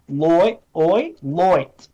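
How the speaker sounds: "loit" with an oy vowel; broad Australian vowel. Shown as a demonstration. Coaching listeners to say "loit" with an Australian oy sound. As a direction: stretch "oi", then land it in "loit". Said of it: "don't say light, okay, say".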